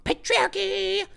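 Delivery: high, slow screeching yell; mock screech. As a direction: screech it like an angry protester.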